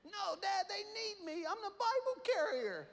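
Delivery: put-on very high, earnest child voice; a small boy proudly insisting; squeaky determined kid. Son insists, voiced by father.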